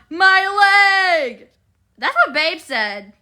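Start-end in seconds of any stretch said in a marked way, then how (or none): 0.1-1.5 higher, louder mock cry; cartoon yell. Streamer quoting the cartoon yelling fish, loud and high. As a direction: yell it like the guy whose leg hurts. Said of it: "the fist that always yells".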